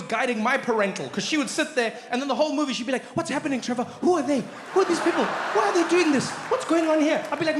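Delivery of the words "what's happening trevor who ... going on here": higher, puzzled mother asking questions; confused mom voice. Retold or imitated. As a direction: play a baffled mom.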